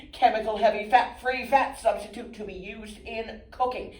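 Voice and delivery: high, loud corporate facilitator; brisk focus group leader. Acted. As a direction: take charge of the room, loud and high.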